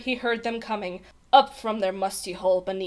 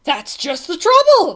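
loud, high-pitched kingly bellow; 8 semitones higher, 11 dB louder than usual. A narrator's reading. King cutting off his page boy, bellowing. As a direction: bellow it in exasperation. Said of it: "bellowed the king".

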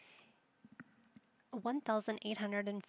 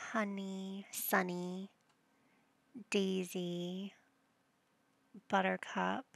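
ordinary talk, nothing marked

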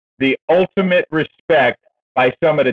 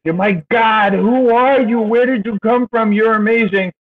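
higher, wide-eyed praise; amazed boss retold; 7 semitones higher than usual. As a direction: echo the boss's amazement up high. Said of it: "and he says".

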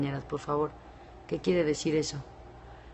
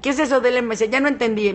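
ordinary talk, nothing marked

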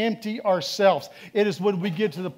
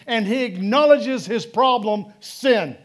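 loud, raised preaching voice; 4 semitones higher, 6 dB louder than usual. Acted. Pastor preaching to the congregation, loud and fervent. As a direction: build the sermon up, loud and high.

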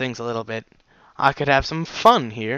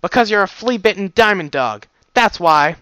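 loud, higher contemptuous retort; snorting scorn; 7 semitones higher, 7 dB louder than usual. Acted. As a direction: sneer it down at him. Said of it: "She snorted at me".

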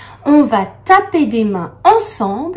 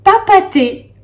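"papaté" called out higher and louder; cheerful louder "papaté"; loud model answer; 9 semitones higher, 7 dB louder than usual. Shown as a demonstration. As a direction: announce "papaté" triumphantly. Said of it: "ça donne".

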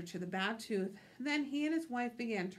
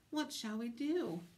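ordinary delivery, nothing marked

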